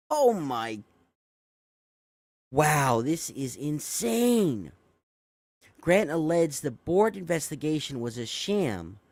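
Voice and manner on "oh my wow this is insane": very slow, stretched-out amazement; stunned disbelief. Real, not acted. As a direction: drag out the disbelief. Reacting to shocking quote.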